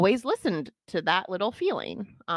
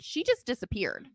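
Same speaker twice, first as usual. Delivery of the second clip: ordinary talk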